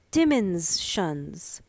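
"Dimens-shuns" split slowly into two heavy syllables; sounding it out. Acted. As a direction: spell the word out slowly like a child. Child sounding out a hard word.

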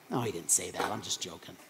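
usual speaking voice

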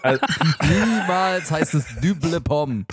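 slow, laughing denial; laughing disbelief. Real, not acted. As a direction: laugh through the protest. Laughing at joke word.

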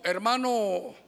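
ordinary talk

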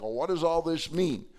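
normal talking voice

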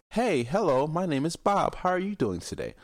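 high, prim, polite greeting; proper polite voice. Retold or imitated. Mocking how people greet others, high and prim. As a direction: put on a high proper voice to mock them. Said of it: "they talk like".

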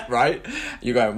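ordinary talk

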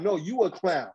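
ordinary talk, nothing marked

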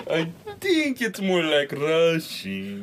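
slow, loud, high hammed Russian accent; mock Russian accent. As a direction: drag it out in a fake Russian accent. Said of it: "speak with a Russian accent".

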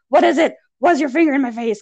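high, loud retold challenge; indignant retort. Speaker answering a coworker's wagging finger, indignant.

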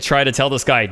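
normal talking voice

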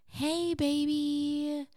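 high, soft, drawn-out cooing; slow, reassuring baby-talk greeting; girlfriend voice. Shown as a demonstration. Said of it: "which is a higher pitched tone that is soothing for reassurance".

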